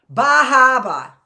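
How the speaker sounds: Boston accent, loud, high and drawn out. Shown as a demonstration. Showing off her Boston accent to viewers, loud and slow. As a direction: belt a broad Boston accent. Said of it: "That's my Boston accent".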